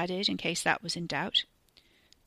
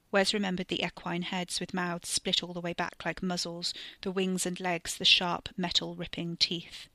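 ordinary delivery

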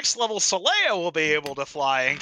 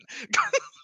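high laugh. Real, not acted.

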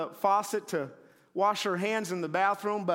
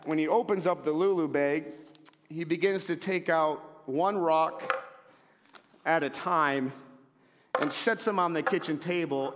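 ordinary talk

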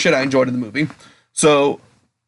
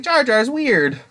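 put-on kid's puzzled remark; 10 semitones higher, much slower than usual. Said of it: "as a kid, I was like".

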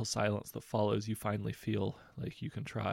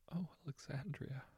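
softer, gently murmured; 6 semitones higher, 9 dB quieter than usual. A narrator's reading. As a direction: say her name under your breath. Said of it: "you murmur".

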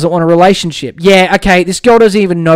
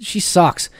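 normal talking voice

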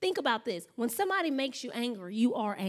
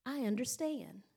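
calm understanding; 7 dB quieter than usual. Retold or imitated. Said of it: "and he said".

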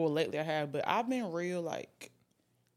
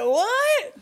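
high shocked what; surprised; 12 dB louder than usual. Real, not acted. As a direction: blurt it out in disbelief. Reacting to the funny tag.

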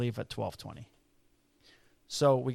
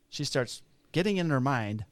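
ordinary talk

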